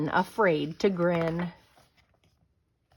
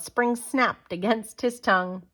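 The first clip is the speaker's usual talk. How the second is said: plain reading voice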